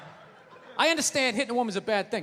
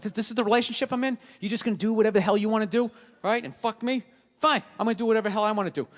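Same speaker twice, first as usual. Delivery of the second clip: fast resentful rant; 3 semitones lower, faster than usual. Retold or imitated.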